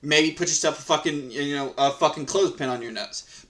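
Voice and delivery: ordinary talk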